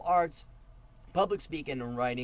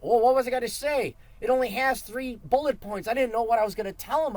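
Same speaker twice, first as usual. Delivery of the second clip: mock worried speaker; 9 semitones higher, 7 dB louder than usual. Mocking a speaker forgetting notes.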